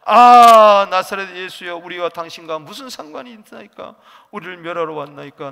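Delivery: loud cry. A narrator's reading. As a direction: shout it out as the possessed man. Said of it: "크게 소리질러".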